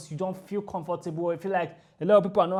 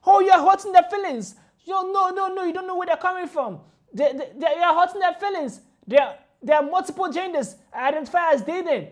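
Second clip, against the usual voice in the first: higher, louder mock of whining protesters; 8 semitones higher, 7 dB louder than usual. Vlogger parodies the protesters' complaints, loud and mocking. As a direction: parody their outrage, exaggerated. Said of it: "So when it comes to".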